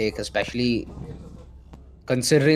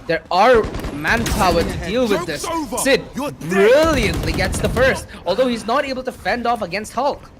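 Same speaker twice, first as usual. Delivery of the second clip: esports caster; 6 semitones higher than usual. Real, not acted. Caster calls a fight.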